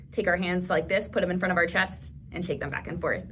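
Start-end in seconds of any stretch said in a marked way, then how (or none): none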